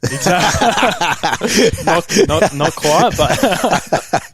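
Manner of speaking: laughing through the words; cracking up mid-sentence; giggling denial. Real, not acted. Laughing at gym joke.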